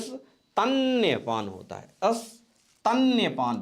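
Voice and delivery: स्तन्यपान drawn out very slowly, stan-ya-paan; slow dictation. Shown as a demonstration. Teacher stressing स्तन्यपान to students, very slowly. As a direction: spell स्तन्यपान out syllable by syllable. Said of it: "बहुत important".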